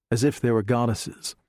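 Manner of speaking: ordinary delivery, nothing marked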